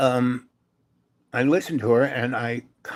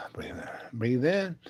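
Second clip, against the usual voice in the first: soft breathing cue; 2 semitones higher, 7 dB quieter than usual. Retold or imitated. Calming a weeping woman.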